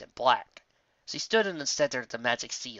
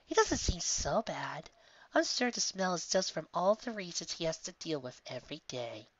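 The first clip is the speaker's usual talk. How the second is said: whispered mock reassurance; 5 semitones higher, 6 dB quieter than usual. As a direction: pretend to defend him.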